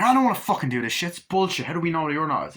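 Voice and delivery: loud, high, fast mock protest; acted arguing. Crew arguing over the test, acted heated protest. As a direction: burst out as if in a heated argument. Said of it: "where they're arguing".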